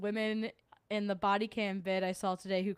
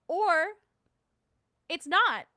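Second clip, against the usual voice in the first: higher, louder sarcastic retort; 10 semitones higher, 8 dB louder, slower than usual. Retold or imitated. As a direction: shoot it down with a high sarcastic jab. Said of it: "I was like".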